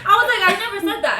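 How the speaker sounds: high, loud mock denial through laughter; amused, loudly insistent mock innocence; laughing denial. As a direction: repeat the retold denial, cracking up.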